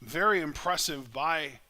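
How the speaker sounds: lively live play-by-play call; wrestling commentator. Real, not acted. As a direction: call the match as it happens.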